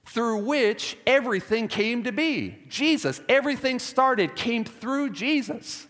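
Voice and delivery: high, insistent preaching, slowing at the end; emphatic proclamation. Real, not acted. Preacher voicing Paul's big idea to the congregation, emphatic. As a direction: proclaim it, hitting EVERYTHING and JESUS high. Paul's just kind of shouting this.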